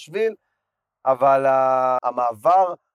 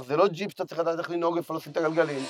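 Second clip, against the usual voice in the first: ordinary talk, nothing marked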